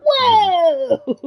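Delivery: high, loud whoa breaking into laughter; hammed-up wonder, very high; mock amazement. Cohost mocking games that don't work, mock amazement.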